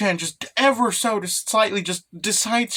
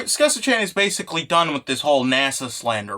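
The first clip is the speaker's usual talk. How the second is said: ordinary talk, nothing marked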